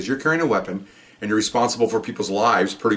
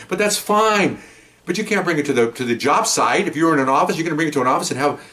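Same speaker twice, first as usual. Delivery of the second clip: ordinary delivery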